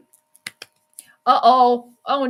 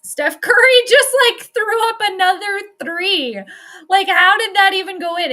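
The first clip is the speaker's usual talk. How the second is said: amazed disbelief; 7 semitones higher, 7 dB louder than usual. Real, not acted. Amazed at Curry's three.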